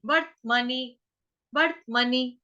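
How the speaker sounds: slow वर्तमनि, closing vart before mani; half t demo. Shown as a demonstration. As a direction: pause lightly inside वर्तमनि after the t. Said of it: "आधा त, वर्त".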